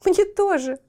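high, loud yelp of fright; frightened cry. A narrator's reading. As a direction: squeal as if burned. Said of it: "испугалась Метиль". (испуганный вскрик, высоко и громко)

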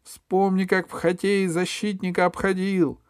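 very high, insistent call; crying out. A narrator's reading. Alisa reminds Pashka of hockey, crying out. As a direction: urge him on in a high cry. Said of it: "крикнула Алиса изо всех сил".

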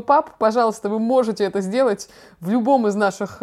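normal talking voice

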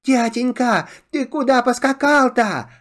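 put-on high child voices, puzzled; little boys asking. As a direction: ask like small sons, wide-eyed. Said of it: "спрашивают".

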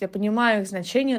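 ordinary talk

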